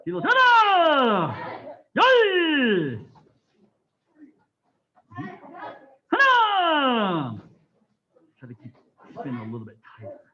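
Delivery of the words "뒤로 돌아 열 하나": high, loud calls with long falling tails; shouted drill commands. Real, not acted. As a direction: bellow each command, stretching the last vowel. Instructor calls out counts and turns.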